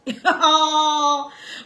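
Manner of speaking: loud laugh; real laugh after a jab; loud mocking laugh. Real, not acted. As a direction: laugh out at the rival. Laughs at own mockery.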